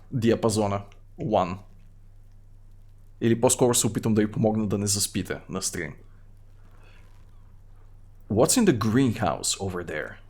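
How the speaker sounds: normal talking voice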